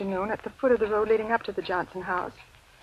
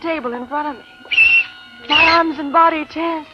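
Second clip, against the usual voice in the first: tense dread; 6 semitones higher, 6 dB louder than usual. A narrator's reading. Narrator reliving the chalk scrape.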